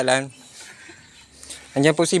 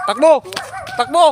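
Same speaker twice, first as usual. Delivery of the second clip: urgent yell to run; shouting run; 5 semitones higher than usual. Real, not acted. Fleeing a dog, calling out.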